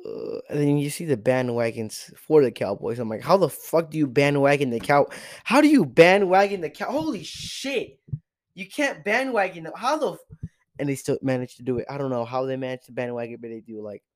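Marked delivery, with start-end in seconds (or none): 3.2-10.5 high, incredulous outburst; worked-up, high-pitched rant; exasperated disbelief. Acted. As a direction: raise the pitch in mock outrage. Acted outrage at bandwagon fans.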